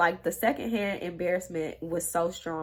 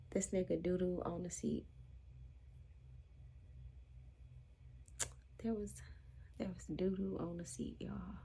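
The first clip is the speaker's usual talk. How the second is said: dropping to a near whisper in shock; hushed stunned reveal; hushed disbelief; 15 dB quieter than usual. Retold or imitated.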